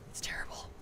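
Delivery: breathy whispered aside. Real, not acted. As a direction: drop to a whisper. Aside about painful chin cramps.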